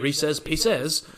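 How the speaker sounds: Reese's Pieces with a jokey French lilt, Pieces as pee-SEZ; mock French accent. Cohost says the candy name in his fake French accent. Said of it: "who has a French accent".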